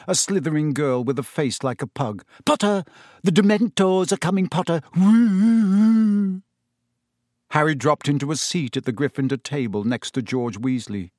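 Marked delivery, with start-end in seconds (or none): 2.5-6.4 shrieked taunt, high and louder on the ghostly woos; mocking high schoolgirl jeer with spooky hooting; Pansy Parkinson. A narrator's reading. Pansy teasing Potter about the Dementors, shrieked. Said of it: "shrieked Pansy Parkinson".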